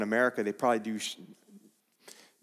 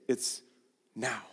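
usual speaking voice

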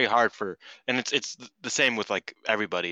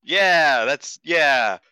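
mock awkward yeah; 5 semitones higher, 8 dB louder, much slower than usual. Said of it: "Then you're like".

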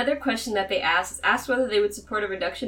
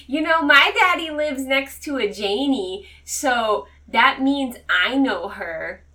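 put-on slow, higher-pitched little kid; drawn-out kid voice, pitched up; kindergartner voice; 5 semitones higher, 5 dB louder, slower than usual. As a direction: say it like a kindergartner proudly telling. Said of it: "kindergarten, he would be like".

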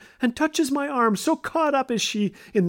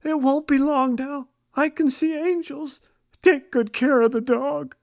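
put-on feeble and high, like a dying boy; weak little voice; 6 semitones higher than usual. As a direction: play it weak and pitiful, a little higher. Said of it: "in a weak little voice".

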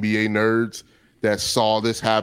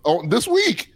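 Kendrick Perkins impression; 6 semitones higher than usual. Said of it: "He was like".

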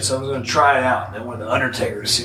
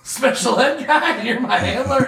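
much higher, laughing mock outrage; shocked disbelief; 13 semitones higher than usual. Hearing his new gimmick from the boss, laughing high disbelief. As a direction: squeal it like you can't believe your luck. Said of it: "And I was like".

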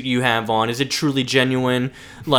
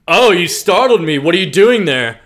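louder, startled put-on voice; mock Lana; 5 semitones higher, 5 dB louder than usual. Lana caught crying in the barn, startled and loud. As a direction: act startled, a little louder.

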